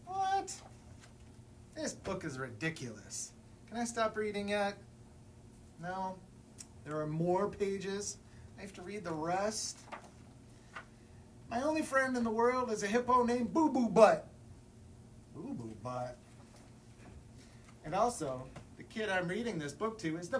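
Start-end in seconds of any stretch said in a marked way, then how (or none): 0.1-0.5 high, squeaky disbelief; shocked what. Acted. Reader shocked by the book. 11.5-14.3 louder, excited declaration; proud silly announcement. Acted. Book forces silly declaration. 15.3-16.2 low, flat disbelief; disbelieving mutter. Acted. Reader repeats name in disbelief.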